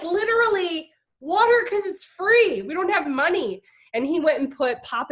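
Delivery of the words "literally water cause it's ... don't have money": high, loud fed-up answer; exasperated retort. Retold or imitated. Wife telling husband to serve free water, high exasperated shout. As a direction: snap it back, out of patience. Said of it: "I'm like".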